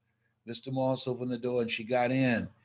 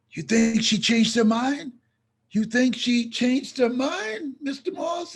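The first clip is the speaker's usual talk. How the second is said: anxious question; 9 semitones higher, 4 dB louder than usual. Retold or imitated. Anxious question as car stops.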